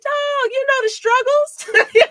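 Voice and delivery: high, slow, laughing appeal; high and slow through a laugh; laughing mock plea. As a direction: stretch it out like a wounded buddy. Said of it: "You be like".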